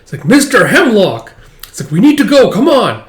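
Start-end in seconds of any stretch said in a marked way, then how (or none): 0.2-1.3 louder, higher drawn-out call; a hammed-up urgent call. Calling out. 1.9-3.0 much higher, loud urging; a hammed-up frantic girl; urgent call.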